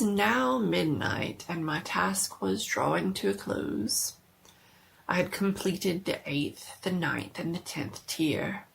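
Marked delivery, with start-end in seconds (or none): none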